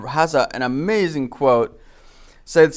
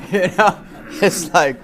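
laughs through the words after the okra joke; laughing; 6 semitones higher than usual. Real, not acted. Teacher to class, laughing at his own joke. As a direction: let the laugh spill into it.

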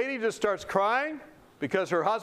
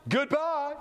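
high mock send-off with a laugh; imitated teasing, high-pitched and laughing; 8 semitones higher than usual. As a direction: laugh at them as you wave them off. Staff mockingly send him in.

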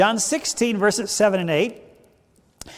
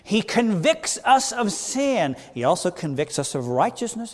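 ordinary talk

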